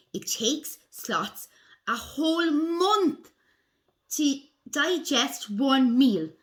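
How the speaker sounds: slow, deliberate storybook reading; picture-book read-aloud. A narrator's reading. Reading sloth facts to children, slow storybook voice. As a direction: read slowly to young children, weighting key words.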